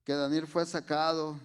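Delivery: loud, rising sermon climax; fervent preaching. Real, not acted. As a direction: preach it out with full conviction. Preaching at full force.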